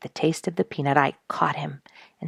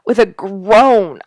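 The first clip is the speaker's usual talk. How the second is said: groan; 4 semitones higher, 10 dB louder, slower than usual. A narrator's reading. Narrator recalls painful moment.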